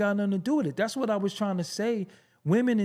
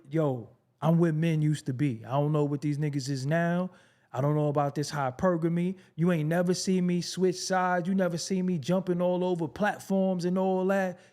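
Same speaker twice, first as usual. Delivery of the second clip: ordinary delivery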